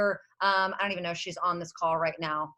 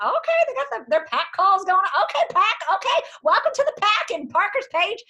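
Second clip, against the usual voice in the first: high bubbly hostess gush, louder; gushing team welcome; 11 semitones higher, 7 dB louder than usual. Retold or imitated. Welcoming the new pack on a call, squealing high and loud. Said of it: "I'm like".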